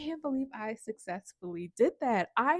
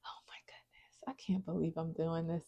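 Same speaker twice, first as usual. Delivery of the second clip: breathless whisper of disbelief; awed whisper; 4 semitones lower, 6 dB quieter than usual. Real, not acted. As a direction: whisper it like you can't believe it. Overwhelmed by the result.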